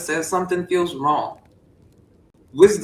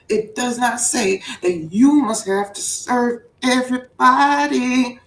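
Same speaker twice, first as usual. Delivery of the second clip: slow, then higher and louder; building preacher shout; 7 semitones higher, 3 dB louder, slower than usual. Pretend. Preacher whipping up the congregation, louder. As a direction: climb into a full shout. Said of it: "I be getting fired up".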